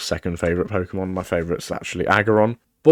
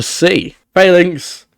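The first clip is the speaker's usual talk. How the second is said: exasperated exclamation; 9 semitones higher, 6 dB louder, slower than usual. Real, not acted. Spots an abandoned Pokemon, exasperated.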